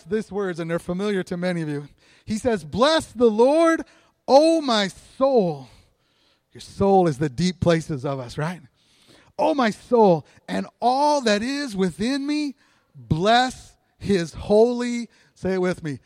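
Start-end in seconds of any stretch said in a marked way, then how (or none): 2.6-5.7 loud, raised, drawn-out scripture reading; psalm proclamation. Acted. Preacher recites David's psalm to the congregation, loud proclamation. 9.4-15.1 stretched-out, emphatic scripture reading; psalm proclamation. Acted. Preacher reads the psalm for all to join, slow proclamation.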